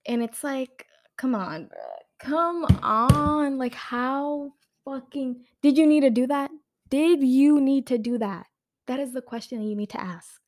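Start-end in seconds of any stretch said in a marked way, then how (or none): 2.2-5.4 heated outrage. Real, not acted. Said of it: "how heated I look". 5.6-6.5 louder, higher demand; heated. Real, not acted. To the filmmakers, a louder heated demand. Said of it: "how heated I look". 6.9-8.5 slower, weighted echo of the question; heated, slower repeat. Real, not acted. Repeating it at the filmmakers, slow and heated. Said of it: "how heated I look".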